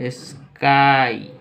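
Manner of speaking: स्काई very slow, split as "is-kaai"; pronunciation demo. Shown as a demonstration. Teacher showing this way of pronouncing it, slow drawn-out स्काई. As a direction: stretch स्काई into two long parts. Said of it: "इस तरह से हम लोग इसका pronunciation करेंगे".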